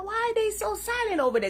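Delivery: quick, high puzzled aside; puzzled wondering. Retold or imitated. Wondering aloud why the rivals went quiet, high and quick. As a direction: ask it like you honestly can't figure them out. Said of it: "I'm like".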